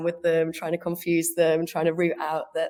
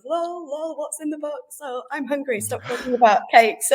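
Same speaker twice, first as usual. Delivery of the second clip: high, jokey reading of chat messages; mock chat voice; 8 semitones higher, 4 dB louder than usual. Reading silly podcast chat messages, in a high mock voice. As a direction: toss off the chat lines playfully.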